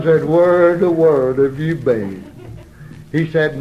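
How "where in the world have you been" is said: slow, loud, stretched-out question; lightly acted hillbilly ma; mountain ma's greeting. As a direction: drag it out like a backwoods mother. Ma greets returning son.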